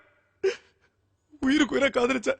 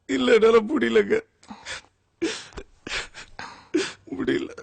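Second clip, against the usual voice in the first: crying. Acted. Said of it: "அழாதீங்க".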